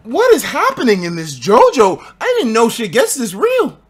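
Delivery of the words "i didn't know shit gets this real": usual speaking voice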